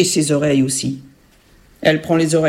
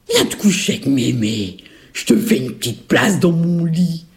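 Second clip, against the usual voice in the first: luring ogress-grandmother. Acted. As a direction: coax the child to come closer. Ogress lures girl into bed.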